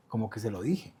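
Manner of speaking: se lo dije said softly, almost under his breath; quiet telling. Retold or imitated. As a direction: let se lo dije slip out quietly. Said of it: "Pero ese "se lo dije" era más un como".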